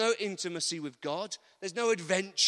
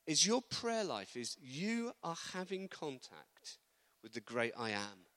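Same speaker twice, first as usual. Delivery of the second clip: ordinary delivery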